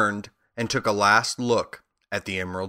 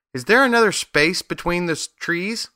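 calling out; 8 semitones higher than usual. A narrator's reading. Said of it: "called the lion".

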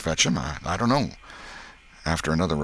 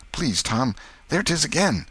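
put-on raised-pitch, scared boy; plead, then jump higher in alarm; fearful plea; 10 semitones higher than usual. Huck begs Tom as the howl returns, fearful.

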